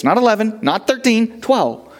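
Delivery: Not eleven, not thirteen rising high, then twelve landing; stress on twelve. Acted. Preacher to the congregation, insisting on twelve with stress. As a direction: count them off and land on twelve. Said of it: "exactly, twelve baskets".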